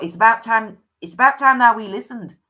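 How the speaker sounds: put-on half-hearted Scottish accent; mock Scottish spirit scolding. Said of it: "I can't do it in a Scottish accent".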